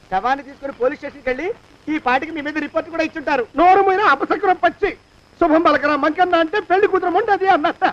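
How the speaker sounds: ordinary delivery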